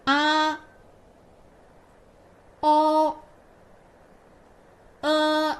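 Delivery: teacher reviews the three finals; pinyin finals. Shown as a demonstration. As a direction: lay out the three vowels one by one. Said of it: "Read after me".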